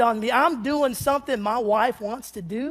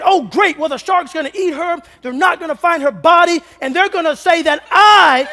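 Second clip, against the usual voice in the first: louder, higher, rising alarm; mock panic spiral; 4 semitones higher, 7 dB louder than usual. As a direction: spin out an imagined disaster in mock terror. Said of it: "I'm thinking".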